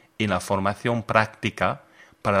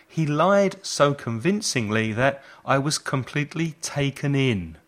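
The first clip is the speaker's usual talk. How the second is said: ordinary talk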